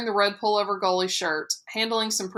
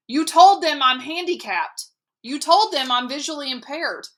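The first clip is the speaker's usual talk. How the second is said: accusing; 7 semitones higher, 4 dB louder than usual. A narrator's reading. Boy accusing mother.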